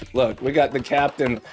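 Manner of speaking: ordinary delivery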